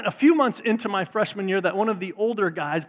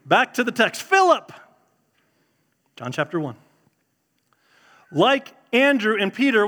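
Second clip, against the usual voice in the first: ordinary delivery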